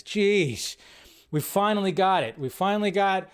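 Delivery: usual speaking voice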